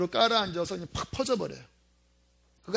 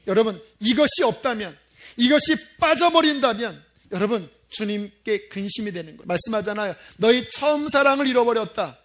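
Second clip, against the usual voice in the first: emphatic sermon warning, surging louder; forceful preaching; 2 semitones higher, 6 dB louder than usual. Real, not acted. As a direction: press the warning on the congregation. (힘주어 경고하는 설교)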